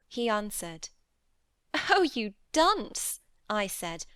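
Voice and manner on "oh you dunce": mocking laugh. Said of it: "laughing heartily at his failure".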